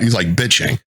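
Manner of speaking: normal talking voice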